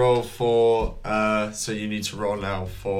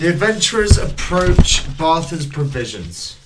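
higher, louder narration cutting in; loud scene announcement; 8 semitones higher, 8 dB louder than usual. A narrator's reading. Game master steering players to the provisions, loudly announcing.

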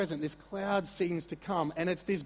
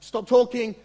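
sudden loud high bark of a command; commanding shout; 7 semitones higher, 13 dB louder than usual. Retold or imitated. God silencing Peter with a loud shout. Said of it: "He's like".

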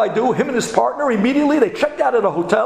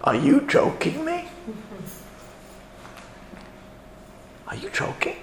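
quiet, incredulous airline agent; hushed disbelief; 9 dB quieter than usual. Retold or imitated. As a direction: let the question drop almost to a whisper.

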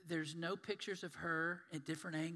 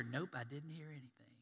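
whispered, unbothered reply; sleepy whisper; 3 semitones lower, 6 dB quieter than usual. Retold or imitated. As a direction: shrug it off in a whisper. Sleeper answering family.

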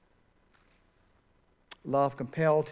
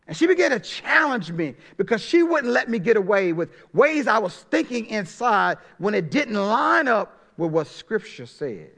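preaching with force; 7 semitones higher, 6 dB louder than usual. Real, not acted. Preaching climax.

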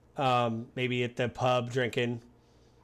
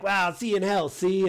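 mock old-timey American, higher and louder; 1920s American; 7 semitones higher, 5 dB louder than usual. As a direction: lightly put on a 1920s American voice. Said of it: "Could you be American from the nineteen twenties".

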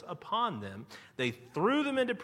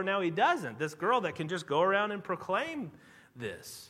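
ordinary talk

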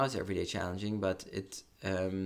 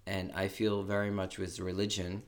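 usual speaking voice

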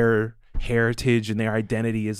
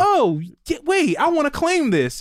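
put-on high-pitched eager kid reaction; excited discovery. Host voices kids wanting in on it, excited. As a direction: act out a thrilled realization. Acts out kids claiming the culture.

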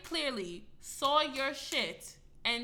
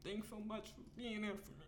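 mocking teary friend; 10 dB quieter than usual.

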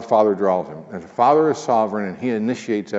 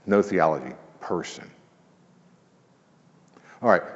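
ordinary delivery

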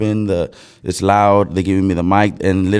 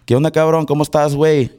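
higher Mexican slang accent; 5 semitones higher than usual. Shown as a demonstration. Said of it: "And then Mexican".

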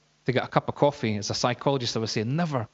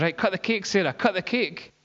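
higher-pitched, insistent urging; retold urging; 6 semitones higher than usual. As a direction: urge her on, pitched up. Retold urging to cut cake.

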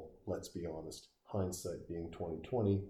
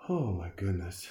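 usual speaking voice

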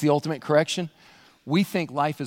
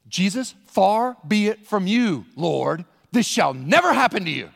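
slow, emphatic admonishment; drawn out, then punched louder at the end; Peter rebuking; 3 semitones higher, 4 dB louder than usual. Acted. Peter rebuking Jesus, emphatic and slow. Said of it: "began to rebuke him".

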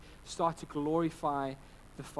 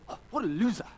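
retold exasperated jeer, higher and louder; frustrated outburst; 7 semitones higher, 5 dB louder, faster than usual. Dad retelling his reaction to a zooming car, frustrated. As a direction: re-enact your own irritated outburst at a driver. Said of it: "out of frustration".

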